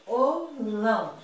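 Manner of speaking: VIOLÃO said slowly, syllable by syllable; separado em sílabas. Shown as a demonstration. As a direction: break Violão into vi, o, lão with pauses. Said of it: "Vi é uma sílaba, o o é outra sílaba". (Violão pausado, sílaba por sílaba)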